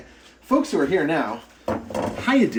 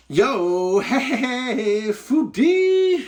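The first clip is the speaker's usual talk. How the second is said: excited greeting; 10 semitones higher, much slower than usual. Real, not acted. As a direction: call out to a friend, stretching it slow and high. Greeting a viewer in chat.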